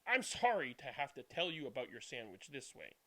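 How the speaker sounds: lively, expressive picture-book reading; storybook reading. Acted. Reading a picture book aloud to young viewers, expressive storytelling. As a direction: read it like a bedtime story for small children.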